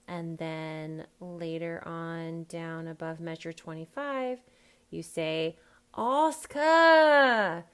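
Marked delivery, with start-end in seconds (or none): 6.0-7.6 loud, higher drawn-out "her" as uh; dropped H. Shown as a demonstration. Coach calling the actor's Cockney line, dropped H. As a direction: call out ASK HER as ask-uh. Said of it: "a beginning H is dropped".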